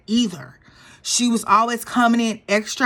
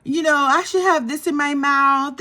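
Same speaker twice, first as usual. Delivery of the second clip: mocking Brynn; 6 semitones higher than usual. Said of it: "what she said".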